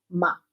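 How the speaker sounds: short falling MA; fourth tone. Shown as a demonstration. Teacher running through the tones, curt descending "ma". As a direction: drop "ma" as if cross. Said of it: "y el cuarto".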